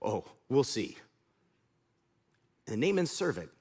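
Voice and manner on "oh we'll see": low, quiet mock grumble; angry grumbling. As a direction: grumble sulkily under your breath as you leave. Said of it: "He's going home angry".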